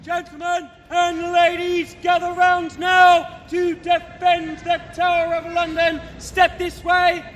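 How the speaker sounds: loud, drawn-out rallying cry; big outdoor proclamation; town crier call. Acted. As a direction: summon the crowd to battle. Guard rallies visitors to defend the Tower.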